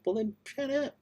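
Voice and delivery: a mock high-pitched shut up; teasing brush-off to a nagging fan; sassy retort. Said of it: "And I'm like".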